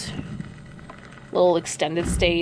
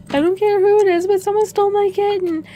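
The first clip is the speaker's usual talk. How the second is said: very high, loud hysterical wail; mock hysterical cat owner screaming; frantic woman shrieking; 14 semitones higher, 6 dB louder than usual. Same woman keeps shrieking.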